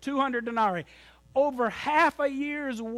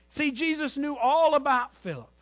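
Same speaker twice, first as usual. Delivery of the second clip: usual speaking voice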